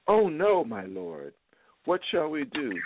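high, frightened cry of the servant; panicked servant. Acted. Servant panicking at the army, high frightened cry. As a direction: squeal it out like a terrified servant.

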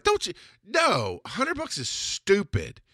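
high-pitched, incredulous retold protest; exasperated objection. Husband rejecting the hundred-buck advice to wife, exasperated. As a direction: draw out the dismissal, pitched well up.

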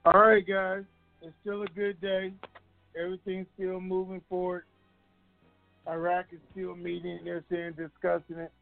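ordinary delivery

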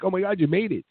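high, excited relief; amazed, voice jumping up high; surprised. Retold or imitated. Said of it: "I'm like".

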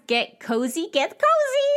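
high, giddy squeal, rising; excited goofy voice. Retold or imitated. Cousins squeezing into one chair before presents, high goofy excitement. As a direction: imitate two giddy kids squealing. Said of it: "in a really goofy voice".

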